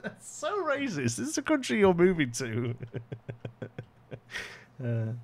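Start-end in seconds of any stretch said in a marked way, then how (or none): 0.0-2.7 high, slow mock-offended voice; hammed-up outrage, drawn out high; mock outraged voice. Streamer mocking a chat reaction, hammed-up high outrage.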